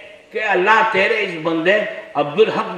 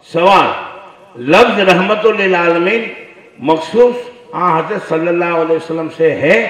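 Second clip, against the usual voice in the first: louder, declaiming the written question and answer; reading a fatwa aloud; 6 dB louder than usual. Shown as a demonstration. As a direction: read the text out firmly to the crowd. (फ़तवा ज़ोर से पढ़कर सुनाना)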